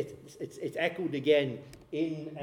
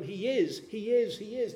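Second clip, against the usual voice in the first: raised, insistent cry without a full scream; mock scream; 6 semitones higher than usual. Prophecies crying out to the leaders, mildly screamed. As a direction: proclaim it higher, hammering each repeat. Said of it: "is screaming out at them".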